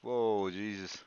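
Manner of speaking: usual speaking voice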